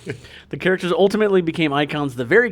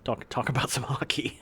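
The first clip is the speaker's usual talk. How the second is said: whisper; 7 dB quieter than usual. Retold or imitated. Joins the McKenzie bit.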